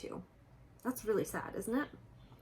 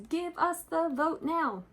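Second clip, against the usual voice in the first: protest sign slogan; 9 semitones higher than usual. Retold or imitated. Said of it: "It says".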